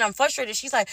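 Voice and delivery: ordinary talk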